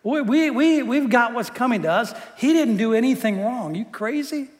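dude-style disbelief; exasperated rebuke. Retold or imitated. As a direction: snap at him like he's lost his mind. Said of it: "He's saying".

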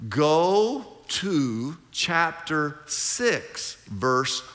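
very slow, spelled-out dictation, x0.3. Shown as a demonstration. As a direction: dictate each word slowly for writing. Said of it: "you write in the margin".

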